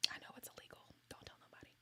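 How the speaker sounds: hushed conspiratorial whisper. Real, not acted. To viewers about screen recording, a secret whisper. Joking confession of illegal recording.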